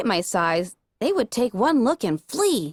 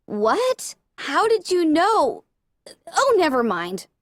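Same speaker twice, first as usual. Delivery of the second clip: startled; 7 semitones higher, slower than usual. Acted. Startled, then flustered.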